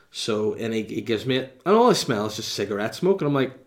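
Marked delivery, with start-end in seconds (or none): none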